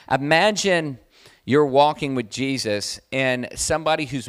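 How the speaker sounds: loud, pressing sermon voice; full-force preaching. Acted. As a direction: drive it home to the congregation.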